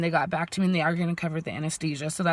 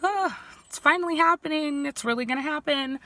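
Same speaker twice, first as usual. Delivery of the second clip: higher-pitched, dawning excitement; excited realization; 8 semitones higher than usual. Retold or imitated. Patient telling viewers her appointments are real now, excited. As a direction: let out a high "oh" of happy anticipation. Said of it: "so I'm excited".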